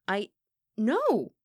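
high, loud, stunned "no"; aghast refusal; shocked denial. A narrator's reading. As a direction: blurt out a startled denial. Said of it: "The accusation was so unexpected".

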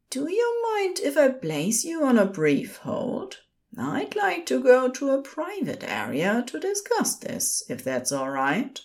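high-pitched, courteous nurse; polite nurse. A narrator's reading. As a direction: reassure the caller politely in a high voice.

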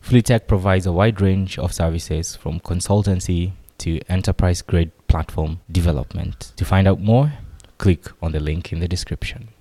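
quiet, even sponsor read. Acted. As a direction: read it like a podcast ad. Sponsor read at episode start.

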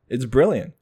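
usual speaking voice

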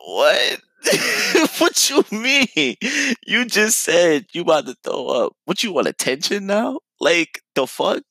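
high, disbelieving retort with a laugh; incredulous. Retold or imitated. Retold incredulous reply to girlfriend.

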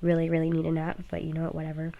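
usual speaking voice

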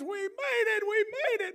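high joyful shout, arms up rising to heaven; excited cheer. Retold or imitated. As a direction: cry out in triumph, way up high. Cheering in the rapture dream.

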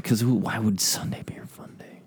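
unvoiced whisper. Real, not acted. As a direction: breathe it out in a whisper. Whispered aside.

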